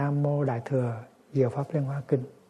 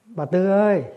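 calling across the yard, a little higher; 5 semitones higher, 4 dB louder than usual. Retold or imitated. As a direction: call her name from the gate. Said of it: "ông kêu".

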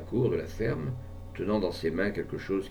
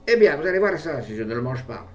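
furious defiance; 5 semitones higher, 6 dB louder than usual. A narrator's reading.

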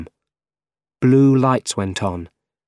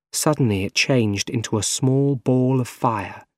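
usual speaking voice

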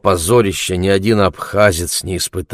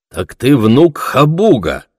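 loud, high, drawn-out exclamation; exclaiming; 7 semitones higher, 6 dB louder, slower than usual. A narrator's reading. Bardusha recognises the guest as Khabug's grandson, exclaiming. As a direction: cry out in slow, ringing recognition. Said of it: "воскликнул Бардуша".

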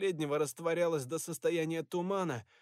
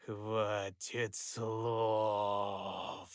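deep, slow, distorted ghoul; spirit voice; 7 semitones lower, much slower than usual. Acted. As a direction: drag it out low and eerie. Plague spirit speaks.

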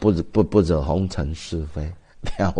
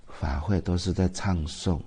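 ordinary talk, nothing marked

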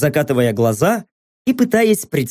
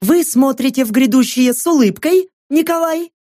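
gushing TV host; 8 semitones higher than usual. Acted. Host's gushing question.

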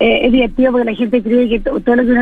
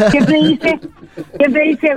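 laughing; 5 semitones higher, much slower than usual. Real, not acted. Laughing at her brother's joke.

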